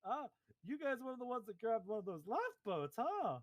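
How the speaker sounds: high, bouncy clown host; hammed-up cheerful clown voice; jolly clown. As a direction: gush over them like a delighted clown.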